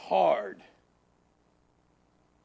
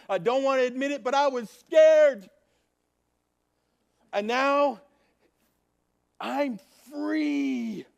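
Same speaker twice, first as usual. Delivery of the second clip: higher, louder, exultant preaching; joyful preaching; 10 semitones higher, 7 dB louder than usual. Real, not acted. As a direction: proclaim the freedom with joy. Preaches the joy of escape.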